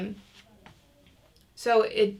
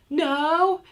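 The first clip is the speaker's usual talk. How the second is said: higher, louder angry "No"; 9 semitones higher, 8 dB louder than usual. Shown as a demonstration. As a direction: snap out an angry "No".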